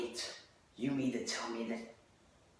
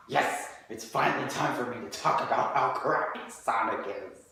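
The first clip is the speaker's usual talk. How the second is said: loud gloating villain, pitched up; sneering panto villain; 3 semitones higher, 4 dB louder than usual. Acted. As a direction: gloat like a cartoon baddie. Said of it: "I am Devil Warp Star".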